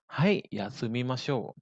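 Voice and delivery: slow, drawn-out teaching delivery; slow model answer. Shown as a demonstration. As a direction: say it slowly for learners to follow. Teacher models answer slowly first. (contoh jawaban diucapkan pelan)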